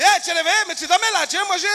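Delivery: high, loud, bubbly hostess voice; hammed-up chatty flight attendant. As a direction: wake the passenger cheerfully. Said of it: "elle m'a dit".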